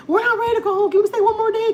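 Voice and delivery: put-on high, loud kid voice; whiny children begging to stay; imitating the kids. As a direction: kids pleading to stay another day. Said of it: "my kids were all like".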